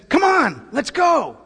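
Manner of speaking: loud, high rallying shout; wild adventurer. Retold or imitated. Pastor voicing Jesus as a wild adventurer, shouting come on. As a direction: call them out on an adventure. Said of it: "the wild adventurer who says".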